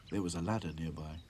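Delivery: ordinary delivery, nothing marked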